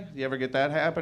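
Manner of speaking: normal talking voice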